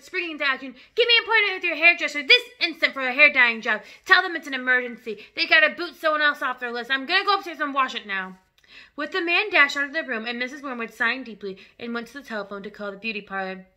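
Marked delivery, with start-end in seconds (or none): 0.9-8.4 loud, urgent orders; shouting father. Acted. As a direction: bark orders in an emergency. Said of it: "the father shouted".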